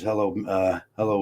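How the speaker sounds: normal talking voice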